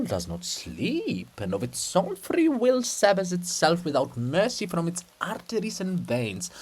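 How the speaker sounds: slow, mock-solemn sermon; lightly mock the princess in lofty words. Methodius mocking the princess with slow, mock-lofty words.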